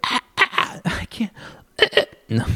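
high airy straining squeaks; breathy high mock squeal then giving up; mock failed squeal. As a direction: imitate yourself failing a dolphin noise. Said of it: "funnier than me actually making the noise".

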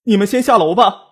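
high-pitched call through the door; calling out loudly. A narrator's reading. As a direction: call out to people outside. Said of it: "冲着门外大声喊道".

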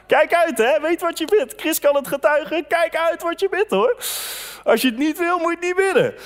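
high, urgent pulpit warning; preaching at full force. Acted. Preacher warning the congregation to watch what they pray, urgently. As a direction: drive the warning home to the church.

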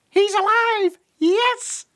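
very high, loud excited squeal; squeaky little cry of joy; tiny chick. A narrator's reading. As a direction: cheer like a thrilled baby bird. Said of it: "said the tiny chick".

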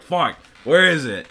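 re-enacted moment of panic; alarmed. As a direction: blurt it like you're frantically searching. Retelling search for missing ladder.

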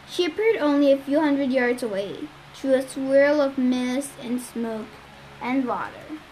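usual speaking voice

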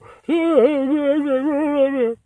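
loud high-pitched laugh. Real, not acted. Laughs at own impression.

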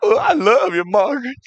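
hammed-up heartbroken plea; anguished pleading. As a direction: cry out for the lost wife, voice way up. Dumped husband wailing for his wife.

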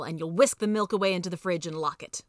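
ordinary delivery

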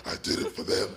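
breathy whispered last words; wounded soldier gasping. Retold or imitated. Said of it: "He's like".

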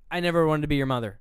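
loud, fast outburst; blurted confession. Retold or imitated. Imitating mother blurting at her son, loud and fast. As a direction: blurt it out loudly in a rush.

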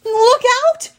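high, loud shout; yelled warning. A narrator's reading. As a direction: yell to warn of danger. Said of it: "I yelled".

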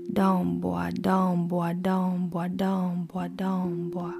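slow, hushed ritual chant; incantation. Acted. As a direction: intone it like an ancient invocation. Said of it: "the poem itself is read almost like an incantation".

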